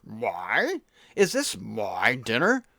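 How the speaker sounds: low, slow pompous king with swooping MY; king of the world. Acted. King questioning his dinner, pompous. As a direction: draw MY out grandly, low then rising. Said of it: "if you're the king of the world".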